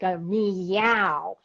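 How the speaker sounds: long drawn-out cat-like "meow"; mock angry yowl. Woman shows how things used to set her off, mock outburst. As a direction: imitate flying off the handle with a stretched yowl. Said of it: "would just set me off".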